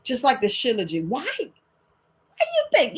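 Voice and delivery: ordinary talk, nothing marked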